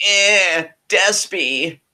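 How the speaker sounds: high, cocky drawn-out greeting; mocking greeting. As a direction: call him out cheekily. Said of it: "Phantasmo's like".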